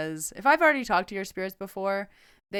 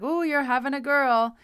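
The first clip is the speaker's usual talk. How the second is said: higher, louder retold exclamation; excited announcement; 7 semitones higher, 5 dB louder than usual. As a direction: act out delighted news-breaking to a client. Acting out her psychic announcement to client.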